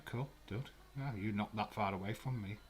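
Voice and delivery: ordinary talk, nothing marked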